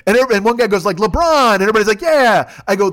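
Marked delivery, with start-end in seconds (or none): 1.0-1.5 loud, higher shout from the crowd; retold fan's shout; calling out. Fan calls out in retold crowd. 2.0-2.5 loud, higher drawn-out yeah; retold crowd shout; crowd cheer. Crowd answers in retold scene.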